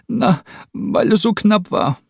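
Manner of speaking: hoch und laut, stockend; aufgewühlter Cameron. Acted. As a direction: den Tränen nah herausgebrochen. Cameron crying, answers Rupert. (Cameron, erschüttert nach dem Beinahe-Sturz)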